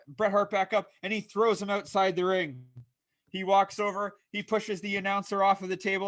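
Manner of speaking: lively ringside commentary, calling every move; wrestling play-by-play. Acted. Host calling his own wrestling show, energetic play-by-play. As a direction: call the match like a TV announcer.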